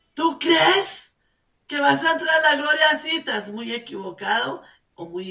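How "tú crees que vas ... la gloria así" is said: high, loud, fast rebuke; loud challenge. Real, not acted. Preacher confronting the sinner, loud and fast. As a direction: call the sinner out, pressing hard.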